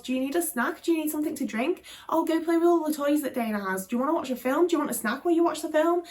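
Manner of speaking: high, sugary, eager-to-please mum voice; sweet hostess mum. Retold or imitated. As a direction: play the doting mum offering snacks. Mum fussing over visiting friends.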